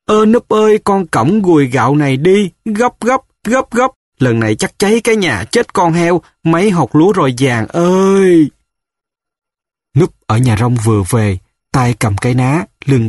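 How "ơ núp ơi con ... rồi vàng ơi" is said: high-pitched, urgent motherly cry; mother calling out. A narrator's reading. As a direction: call out high, hurrying into a wail. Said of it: "Mẹ kêu". (tiếng mẹ kêu gọi cao giọng, hối hả)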